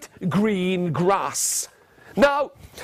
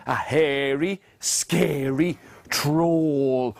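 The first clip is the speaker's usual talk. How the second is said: very slow, low, drawn out; spooky storytelling; 7 semitones lower, much slower than usual. A narrator's reading. Introducing the troll to children, slow spooky telling. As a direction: stretch each word out for menace.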